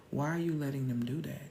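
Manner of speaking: ordinary talk, nothing marked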